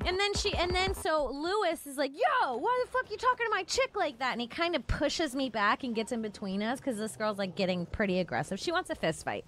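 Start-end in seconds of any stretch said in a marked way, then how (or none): 2.1-4.3 high-pitched retold challenge; mock yelling. Boyfriend confronts a woman over his chick, mock yelling. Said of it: "when Lewis started yelling at her".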